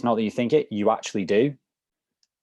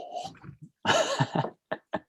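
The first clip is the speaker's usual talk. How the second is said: high laugh; 3 dB quieter than usual. Real, not acted. Laughing at pineapple juice joke.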